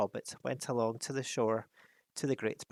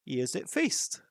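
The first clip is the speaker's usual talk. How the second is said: put-on captain voice; captain of the guard; 3 semitones higher than usual. A narrator's reading. Captain telling Thorin the master feasts. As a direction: brush them off curtly. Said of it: "said the captain".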